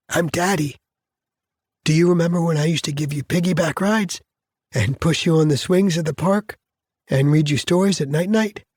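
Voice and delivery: gently help a little one remember; tender daddy voice. Acted. Father reminding his daughter of himself, high tender coaxing.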